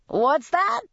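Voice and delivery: put-on high, squinting old man question. A narrator's reading. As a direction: ask it like a half-deaf old man. Old man peering at her.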